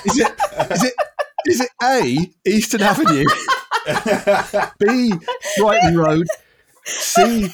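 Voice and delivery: can barely read the options for laughing; laughing through it. Real, not acted. Host reading the quiz options to a guest who already answered, laughing.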